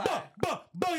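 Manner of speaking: high-pitched mock Jamaican exclamations; hammed-up Jamaican; Jamaican accent. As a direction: punch out syllables in a put-on Jamaican accent.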